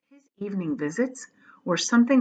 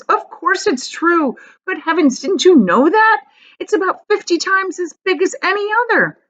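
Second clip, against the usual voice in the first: high, animated exclamation; excited old folks; 10 semitones higher, 3 dB louder than usual. Acted. Grandparents marvelling at Charlie, high and excited. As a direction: marvel at his ignorance.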